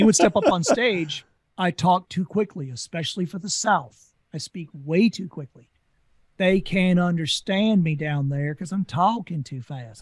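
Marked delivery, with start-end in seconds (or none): 6.4-10.0 slow Southern drawl, imitating his sister; exaggerated, slowed-down Southern speech; Southern accent. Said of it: "That's how my sister speaks".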